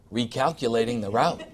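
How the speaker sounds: calmly reroute without blame; mild, kind sat-nav tone; gently. Retold or imitated. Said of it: "He's gonna say really gently".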